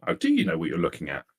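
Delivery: ordinary delivery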